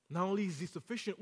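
ordinary talk, nothing marked

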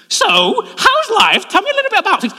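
very high, loud mock female voice; imitated chatty wife. Bored wife starting a chat mid-game, high-pitched imitation. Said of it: "she turns to her friend and goes".

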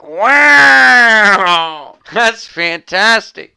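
loud, high gushing wow; sarcastic over-the-top delight; mock amazement. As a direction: act dazzled by simple tech. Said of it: "and I'm like".

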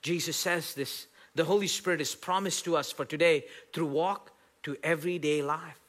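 ordinary delivery

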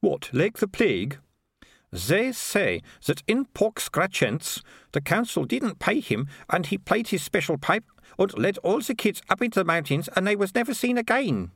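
put-on high, slow accented comic voice; slow, high-pitched tale-telling; gossiping watchmen. Watchmen swap tales of the piper, in high comic voices.

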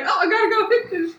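imitating a fussy mom. Daughter imitating her mom fussing over laundry, high mock voice. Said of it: "She's like".

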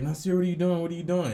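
high, loud teasing call; teacher calling out. Retold or imitated. Classmates calling out to him at the board, high and loud. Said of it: "So they was like".

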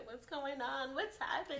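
high, lightly voiced worried mom; a hint of a startled mom; mocking the mom. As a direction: toss it up high like a fussing mother. Mom voiced in retold story.

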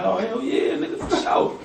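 squeaky, laughing eager agreement; eager high yes. Retold or imitated. Battler answering host's offer of girls, giddy squeaky yes. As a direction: jump at the offer, giggling. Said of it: "I'm like".

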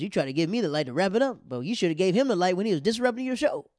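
mock outraged protest at being cut short; retold complaint. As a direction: imitate an indignant comic, loud and high. Kareem complains about being lit.

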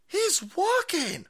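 high, slow mock awe; mock amazement. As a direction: marvel at it sarcastically. Mocking the clip's praise.

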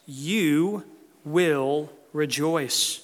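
"You" drawn out and set apart, then a slow "will rejoice"; stress on YOU. Real, not acted. Preacher stressing the second promise to the congregation, heavy stress on "You". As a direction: lean hard on "You", pausing before each word that follows. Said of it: "emphasis on the word "you".

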